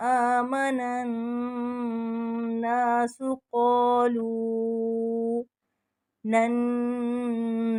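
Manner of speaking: slow melodic Quran chant; tajweed recitation. Shown as a demonstration. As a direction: chant the verse with long vowels. Recites the verse slowly. (धीमी तजवीद तिलावत)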